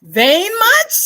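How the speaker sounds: high, loud mocking question; incredulous jab. Poet mocking a guy's vanity, loud and incredulous. Mocking someone assuming poems are about them.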